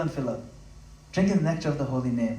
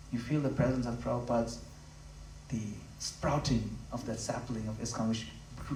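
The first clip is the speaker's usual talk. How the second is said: ordinary talk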